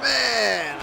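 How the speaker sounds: high yelp of amazement; excited shout. Real, not acted. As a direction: shout it as the dive lands. Commentator reacting to top rope dive.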